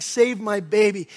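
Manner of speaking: ordinary talk, nothing marked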